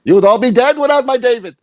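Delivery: hammed-up kvetching father; mock boastful dad.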